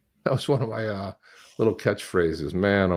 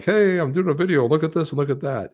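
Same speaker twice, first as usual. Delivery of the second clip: higher, bright mock vlogger intro; 6 semitones higher than usual. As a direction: lightly imitate a cheesy video host. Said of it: "you're sitting there doing a video and you're like".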